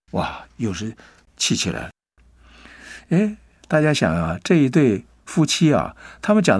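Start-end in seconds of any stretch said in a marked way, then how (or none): none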